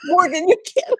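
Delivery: ordinary talk, nothing marked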